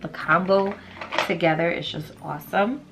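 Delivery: usual speaking voice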